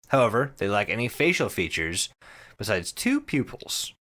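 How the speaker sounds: usual speaking voice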